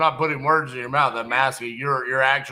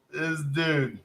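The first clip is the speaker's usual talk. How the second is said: ordinary talk, nothing marked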